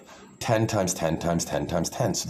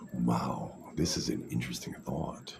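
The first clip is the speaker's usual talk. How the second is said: hushed mock wonder; 8 semitones lower, 9 dB quieter than usual. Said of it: "you're like".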